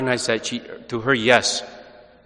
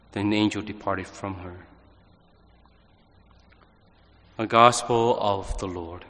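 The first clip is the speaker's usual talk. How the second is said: measured, solemn scripture reading; gospel reading; 4 semitones lower, 5 dB quieter than usual. Acted. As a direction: proclaim the Gospel calmly and steadily. Priest proclaims the Gospel reading.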